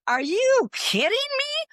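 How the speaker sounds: very high and slow, stretched in disbelief. Retold or imitated. Hearing a woman still dwelling on decades-old cancer, disbelieving. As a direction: stretch it out, stunned.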